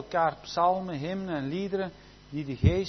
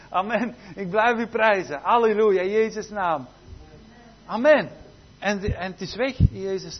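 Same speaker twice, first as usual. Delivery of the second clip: loud, rising praise shouted out; fervent preaching; 4 semitones higher, 7 dB louder than usual. Real, not acted. Preacher praising God to the congregation, fervent and loud. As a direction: preach it with full-voiced fervour.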